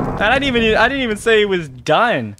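high, loud panicked shrieking; scared horror game streamer. Real, not acted. Screaming at repeated scares.

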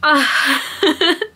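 a short, wet laugh through tears; teary laugh. Real, not acted. Vlogger laughing at herself while crying, wet laugh. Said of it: "I was tearing up".

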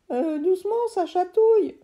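high, loud giggly protest; ticklish friend. Acted. Friend in the tree protesting the screwdriver tickles, high and loud. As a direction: squirm as if tickled. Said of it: "faisait Lionel".

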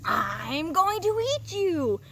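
high, loud, slow troll menace; booming troll threat. A narrator's reading. Troll threatens middle goat on the bridge, slow boom. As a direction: draw out the threat at full boom. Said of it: "the hungry troll boomed".

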